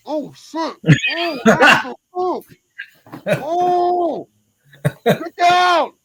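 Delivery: high, frantic yelps of panic; panicked yelling. Retold or imitated. Retelling the cop standoff, high panicked yelps. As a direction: yell it like a gun just came out.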